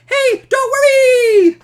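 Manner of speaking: very high, loud and slow squeak; chipmunk-high Superman; squeaky sped-up voice. Pretend. Superman reassuring you at near light speed, very high and loud. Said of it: "he'd come in and be like".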